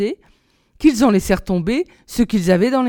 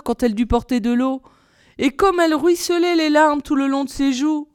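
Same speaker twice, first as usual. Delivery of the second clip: ordinary delivery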